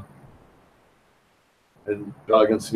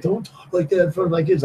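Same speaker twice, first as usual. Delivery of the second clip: higher, fast scolding voice, lightly put on; boyfriend scolding; 8 semitones higher, faster than usual. Sister's boyfriend scolds him in front of the kids, higher fast mock scold. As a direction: snap at him like a protective boyfriend. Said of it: "boyfriend was like".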